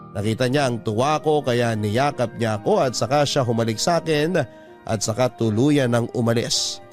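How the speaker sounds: ordinary delivery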